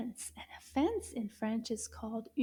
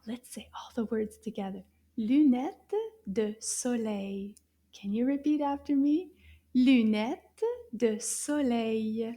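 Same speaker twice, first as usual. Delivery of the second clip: normal talking voice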